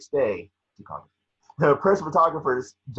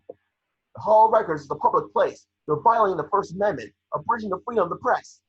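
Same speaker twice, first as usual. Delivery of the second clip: protesting reporter; 9 semitones higher, 5 dB louder than usual. Acted.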